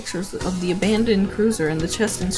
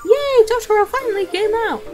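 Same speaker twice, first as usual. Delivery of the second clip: high, bubbly mother voice; cheerful mom voice; 4 dB louder than usual. Acted. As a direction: chirp it like a doting mom.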